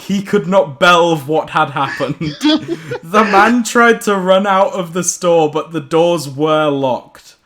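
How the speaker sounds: usual speaking voice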